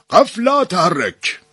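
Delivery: high, slow barked order; a high drawn-out Arabic command; commanding halt. A narrator's reading. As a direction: stop the prisoners dead. Nasser halts the prisoners in Arabic.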